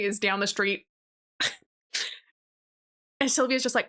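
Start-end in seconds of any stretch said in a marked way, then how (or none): none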